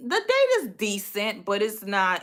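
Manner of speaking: ordinary talk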